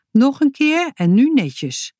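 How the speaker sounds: firm scolding mother's voice; stern mother. A narrator's reading. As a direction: insist like a strict mum. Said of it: "zei ze".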